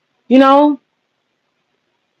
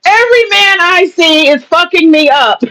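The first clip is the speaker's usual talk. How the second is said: higher, slow, put-on whiny voice; mock victim voice; 6 semitones higher than usual. Parodying feminists, she whines slowly in a mock victim voice. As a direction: drag it out like a hammed-up victim.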